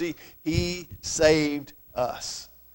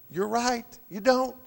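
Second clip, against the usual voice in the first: usual speaking voice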